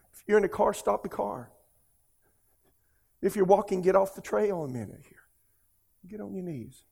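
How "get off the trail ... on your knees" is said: usual speaking voice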